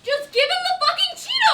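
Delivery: loud, high exasperated yell; angry shout. Real, not acted. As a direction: snap it at them furiously. Exasperated demand.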